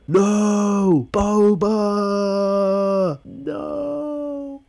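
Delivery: high, hammed-up wail of despair; mock anguished cry. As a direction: cry out like the hero is lost.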